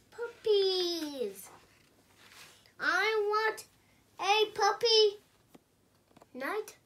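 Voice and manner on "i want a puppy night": higher and louder insistent demand; demanding pigeon. Acted. Pigeon insisting to the reader, higher and louder. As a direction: demand it right now.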